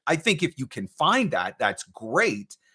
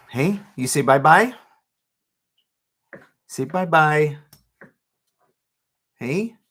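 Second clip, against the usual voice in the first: louder sing-song bye-bye; 4 semitones higher, 6 dB louder, slower than usual. Real, not acted. Dad asking his baby to say bye-bye, louder baby talk.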